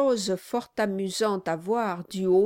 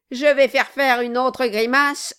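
loud menacing warning; threatening dwarf; 5 semitones higher, 8 dB louder than usual. Acted. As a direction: threaten him. Quilp threatens.

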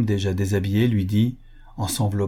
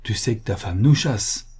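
ordinary delivery, nothing marked

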